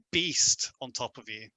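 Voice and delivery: ordinary talk, nothing marked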